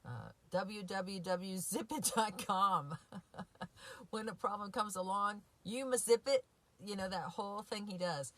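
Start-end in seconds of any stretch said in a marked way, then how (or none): 0.5-6.4 rising into a grand villain's declaration; Doctor Evil impression. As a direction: ham up the movie villain's slogan. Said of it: "clip of Austin Powers when Doctor Evil".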